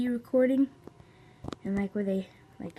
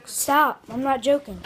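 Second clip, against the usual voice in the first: annoyed; 10 dB louder than usual. Real, not acted. Sibling annoyance.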